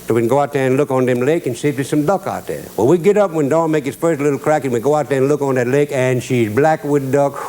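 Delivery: usual speaking voice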